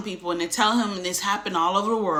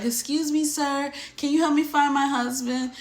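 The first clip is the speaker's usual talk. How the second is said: put-on high, frail little old lady asking for help; timid elderly passenger looking for her husband; 8 semitones higher than usual. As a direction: raise the voice into a fragile elderly woman.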